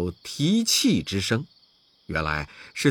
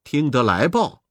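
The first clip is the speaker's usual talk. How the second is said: plain reading voice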